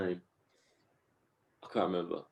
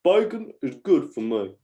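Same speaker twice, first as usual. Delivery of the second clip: higher put-on child's voice; little kid impression; 5 semitones higher, 4 dB louder than usual. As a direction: do a comic little boy's catchphrase. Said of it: "It's like this little kid that".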